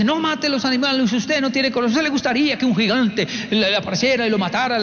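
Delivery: high-pitched indignant reproach; scolding older brother. Retold or imitated. Older son to ant-killing brother, scolding. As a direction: imitate a boy quarrelling in earnest. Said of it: "hay un pleito entre los dos".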